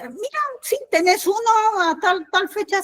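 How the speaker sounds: high helpful clerk. Retold or imitated. Agent tells Cris a ticket is available, high voice. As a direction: pitch up for the agent.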